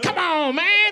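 high, loud sly hello; cocaine greeting. Retold or imitated. Cocaine showing up late at night, high loud hello. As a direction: cocaine as a creepy party guest. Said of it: "He's like".

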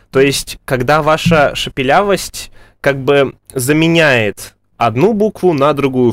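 ordinary talk, nothing marked

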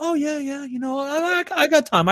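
high, soft feigned interest; leading them on. Retold or imitated. Prankster leading a bill collector on, high mock eagerness. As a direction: play the eager listener to string the caller along. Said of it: "I talk to them and I lead them on".

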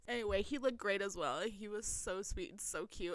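ordinary talk

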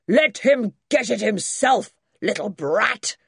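loud, high, indignant granddad; irate old man. A narrator's reading. Great-granddad objecting to the boy's demands, loudly cross.

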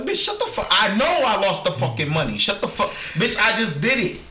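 high-pitched exasperated snapping back; mock outraged retort; acted angry comeback. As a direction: play fed up with her nagging. Acting out the man's angry comeback.

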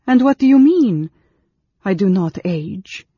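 high, loud, alarmed question; fearful girl. A narrator's reading. Frightened girl demands the mage explain himself, fearful. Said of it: "The mage saw fear in the girl's eyes as she asked this question".

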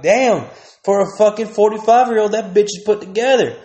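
put-on impressed young dude at the lake; impressed young guy. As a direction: gawk out loud like a dumb kid. Young self ogling older woman.

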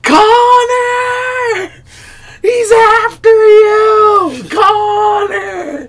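high-pitched drawn-out warning cry; imitated woman's frantic call; screaming woman. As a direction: wail it out long and high, like a woman calling in a nightmare. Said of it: "I just hear a woman go".

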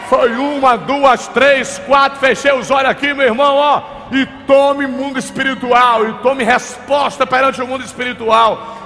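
loud, high, fervent preaching; fiery preaching. Acted. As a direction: preach it at full force. Preaching at full force. (pregação fervorosa em alta voz)

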